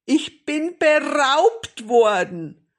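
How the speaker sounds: loud, high, drawn-out wail; distraught grandmother. A narrator's reading. Grandmother announcing she has been robbed, wailing. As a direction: cry it out slowly in outrage.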